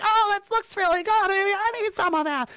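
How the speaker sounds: high mock naive wonder; gullible viewer. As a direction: play the easily sold TV viewer. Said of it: "and you're like".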